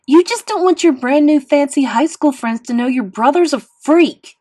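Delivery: loud, high bitter accusation; yelling. A narrator's reading. Boy accusing his sister of shame, yelling. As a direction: keep yelling to the last word. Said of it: "I yelled".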